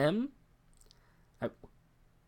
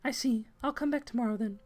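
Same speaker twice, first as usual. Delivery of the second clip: much higher voice for a polite caller; high-voiced visitor; 12 semitones higher than usual. Visitor agreeing to come back, high and polite. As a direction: pitch it way up, politely. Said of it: "I don't know why I made her British".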